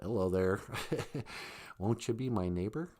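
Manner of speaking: creepy, very low, soft voice; hushed, deep, creepy neighborly invitation; Mister Rogers. Acted. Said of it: "See how creepy his voice is?".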